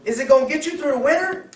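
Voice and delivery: high, loud questioning; a friend pressing a buyer about the car, high and loud. Acted. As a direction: push the buyer for an answer.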